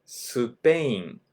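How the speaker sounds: Spain as su-pe-in; スペイン; Japanese Supein. Shown as a demonstration. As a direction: Spain with an added u, su-pein. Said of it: "The country of Spain".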